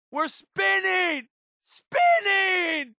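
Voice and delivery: high, drawn-out panicked cry as the craft spins; panicked yell. Acted. Companion to the pilot as the aircraft spins, panicked high yell. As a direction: yell it like you're losing control of the aircraft.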